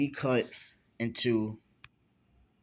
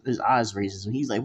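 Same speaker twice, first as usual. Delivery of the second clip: plain reading voice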